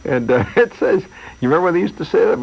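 normal talking voice

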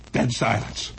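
ordinary talk

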